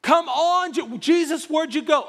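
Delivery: exasperated father, rising to high and loud; impatient Jairus. Acted. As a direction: urge them on with mounting impatience. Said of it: "like, impatient father moment".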